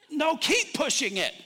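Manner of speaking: loud, high coach urging; acted coach shouting encouragement; inner coach. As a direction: push them on like a coach. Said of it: "like, I'm coach, like, there's two of me".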